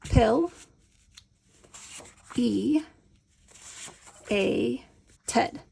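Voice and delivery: one syllable at a time with long pauses; slow syllable split. Shown as a demonstration. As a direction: lay each syllable down slowly. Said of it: "put a card down for each syllable".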